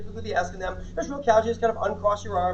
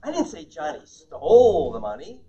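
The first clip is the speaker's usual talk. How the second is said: "stole" drawn out long and raised; stress on STOLE; 5 semitones lower, 3 dB louder, slower than usual. Shown as a demonstration. Trainer hinting it was only borrowed, stress on STOLE. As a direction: slowly stretch "stole", then lift the end on "money". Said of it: "he borrowed it".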